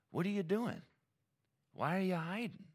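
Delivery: lightly voiced God, high-pitched questions; God questioning. God to Adam and Eve hiding from him, questioning. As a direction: ask it high, as if puzzling over someone hiding.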